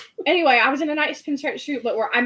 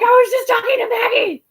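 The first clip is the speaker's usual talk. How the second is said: loud, high-pitched fangirl yell; re-enacted freak-out, shouting with glee; starstruck squeal; 13 semitones higher, 8 dB louder than usual. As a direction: blurt it out in shrill starstruck excitement. Retelling her starstruck freak-out.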